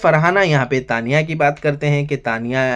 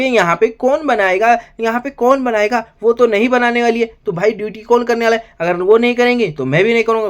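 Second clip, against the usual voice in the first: higher, put-on complaining housemate voice; mock quarrel voice; 8 semitones higher, 3 dB louder than usual. Reviewer voicing Nehal arguing over breakfast duty, mock whiny. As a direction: play the housemate griping, pitched up.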